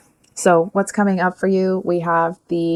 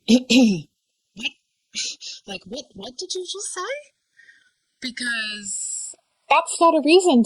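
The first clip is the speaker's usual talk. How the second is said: much higher, hushed incredulous what; animated disbelief; 7 semitones higher, 3 dB quieter, slower than usual. Real, not acted. Reader reacting to the suitor's cheesy line, incredulous. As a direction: react as if you can't believe what you heard. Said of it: "I'm getting a little bit animated".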